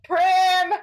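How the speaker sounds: high, loud calling scream; screaming a name. Acted. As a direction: scream out for a missing friend. Ah, screaming. Yes.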